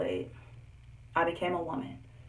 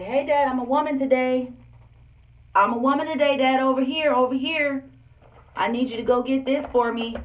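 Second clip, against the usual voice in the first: higher, louder calling out; mock calling out; 7 semitones higher, 6 dB louder than usual. Daughter loudly calling Dad to buy supplies, mock shout. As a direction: call across the store to Dad.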